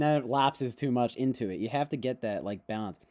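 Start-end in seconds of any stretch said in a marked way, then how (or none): none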